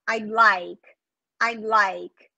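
"I'd" with the D stopped at the palate, unreleased; swallowed D. Shown as a demonstration. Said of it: "É um D engolido".

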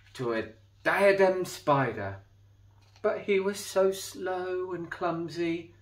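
put-on lively read-aloud for children; storybook reading. A narrator's reading. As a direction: tell it like a bedtime picture book. Reading a picture book aloud.

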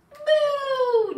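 high, loud, drawn-out moo; long playful storybook moo; baby's moo. Acted. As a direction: moo out like a delighted baby. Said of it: "Baby says".

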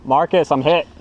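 loud call to a teammate; calling out hit. Real, not acted. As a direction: shout it across the field.

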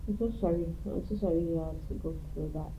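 soft sympathy. Acted. Consoling after sad story.

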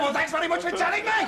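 high, indignant sarcasm; sarcastic thanks. Acted. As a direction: throw back mock thanks, voice pitched right up. Sarcastic retort to boss.

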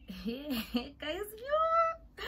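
high squealing laugh-cry; real laughing squeal at the memory. Real, not acted. Crying laughing exclaim.

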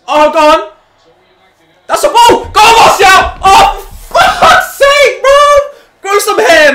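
high, loud frantic yelling at the match; real fan screaming at a near miss; excited shouting. Real, not acted. Fan yelling at players on screen, excited shouting.

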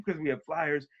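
ordinary delivery

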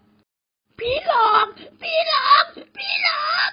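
crying shriek, very high and drawn out; terrified wailing shout; screaming in terror. A narrator's reading. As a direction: scream for help while fleeing. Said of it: "แหกปากร้องตะโกน".

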